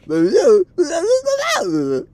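loud, very high gibberish lilt; wordless mock accent that falls away; high wordless babble, swooping down. Shown as a demonstration. Showing up or down, loud high babble falling at the end. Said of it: "just up or down".

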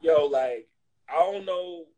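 ordinary delivery

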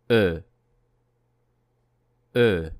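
E with lips rounded as for O; model rounded vowel. Shown as a demonstration. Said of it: "Once again".

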